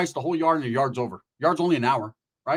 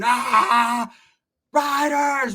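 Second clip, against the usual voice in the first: high calling cry to the riders; a hammed-up call for help; mock yell for help; 7 semitones higher, 3 dB louder, much slower than usual. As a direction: holler for the crew while running. Fat rider yelling while running.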